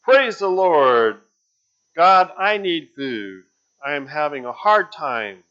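needy lady shouting a prayer from the porch; shouted prayer. Acted. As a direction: cry out to God for help.

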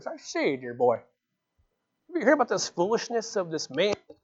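soft mock-English gentleman; English accent. Preacher mimicking a Greek philosopher scoffing at the gospel, English accent. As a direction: put on a quiet, pompous English accent. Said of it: "don't know why he'd have an English accent".

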